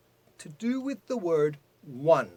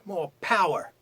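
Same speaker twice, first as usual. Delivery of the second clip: ordinary talk, nothing marked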